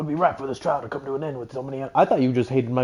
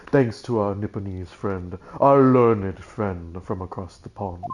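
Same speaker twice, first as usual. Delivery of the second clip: low, slow courtroom nobleman; haughty British prosecutor; 6 semitones lower than usual. Acted. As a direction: deliver the disdain in a lower, deliberate voice.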